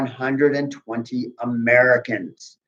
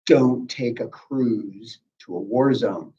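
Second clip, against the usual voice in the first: slow deadpan warning; 4 semitones lower, 5 dB quieter, slower than usual. Acted.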